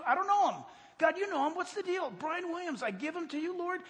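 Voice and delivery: high-pitched, offhand plea to God; re-enacted night prayer. Preacher praying for a newsman at night, acted bewildered prayer. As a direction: rattle off a baffled prayer for a stranger.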